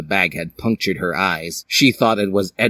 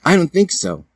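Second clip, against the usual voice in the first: mild denial; 9 semitones higher than usual. Acted. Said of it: "said Hiei".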